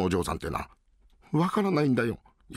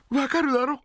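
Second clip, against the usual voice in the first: ordinary talk, nothing marked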